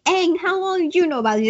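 fast, high, exasperated demand; mad Sokka. Retold or imitated. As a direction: fire the question at him, fed up. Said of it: "Sokka's pretty mad about it".

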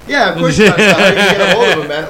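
loud, fast, laughing reply. Real, not acted. Answering his friend's witch doctor joke, loud laughing reply. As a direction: laugh through the words at the joke.

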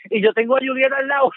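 normal talking voice

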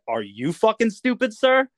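defiant retort. Retold or imitated. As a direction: throw the insult back, punching the final sir. Throws the officer's insult back.

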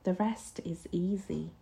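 hushed, menacing undertone; soft, vicious threat; vicious whisper. A narrator's reading. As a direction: drop the voice low in volume, with malice. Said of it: "dropping her voice to a vicious whisper".

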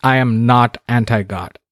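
normal talking voice

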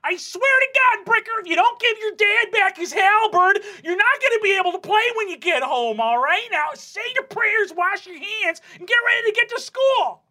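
put-on high, loud exasperated yell at the kids; yelling parent. Mom yells at the brothers to return dad's halberd, loud and high. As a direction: holler at the boys from the living room. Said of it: "yelling out there saying".

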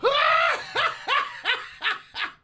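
high, shouted burst of fake laughter; hammed-up laughing for a chat acronym; mock loud laugh. Acts out typed LMAO from chat.

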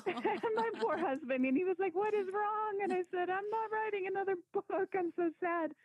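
retelling crying at the bookstore, laughing high; laughing while retelling. Real, not acted. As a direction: laugh at your own tears. Retelling bursting into tears.

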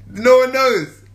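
normal talking voice

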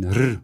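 R drawn out as a rolling trill; rolled R. Acted. Dragon shows the Sams the sound it can say, rolled R. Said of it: "weil ich das jetzt so gut sprechen kann".

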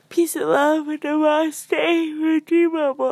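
sing-song sign-off. Real, not acted. Sign-off blessing.

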